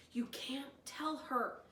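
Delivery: plain reading voice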